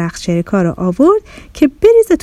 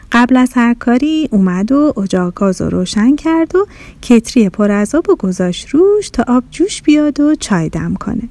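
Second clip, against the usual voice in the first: plain reading voice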